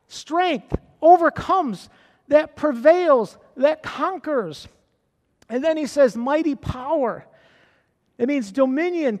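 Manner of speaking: building, emphatic preaching. Acted. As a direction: press each phrase, slowing on key words. Preaching to the church.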